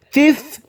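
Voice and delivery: "Teeth" with the tongue between the teeth, pitched high; pronunciation demo. Shown as a demonstration. Teacher shows learners how to say teeth. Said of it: "Tu mets la langue entre les dents".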